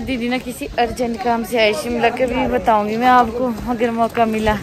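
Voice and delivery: usual speaking voice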